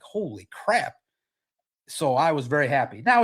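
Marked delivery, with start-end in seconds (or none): 0.0-1.0 stunned reaction. Retold or imitated. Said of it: "I was like".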